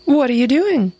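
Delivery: the fox exclaims in alarm as the wolf crouches; startled exclaim. A narrator's reading. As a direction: jump back in surprise. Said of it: "exclaimed the fox".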